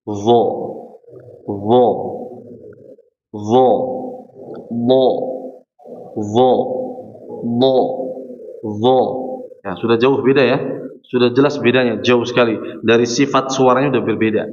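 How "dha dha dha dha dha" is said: "Dha" with tongue tip to the front teeth, firm and clear; letter demo. Shown as a demonstration. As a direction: repeat "Dha" as a model sound. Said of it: "Coba dengarkan".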